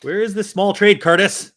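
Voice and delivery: loud, high call for a trade; hammed-up plea to Curtis; mock demand.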